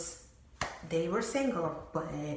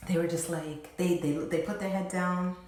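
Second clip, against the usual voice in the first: usual speaking voice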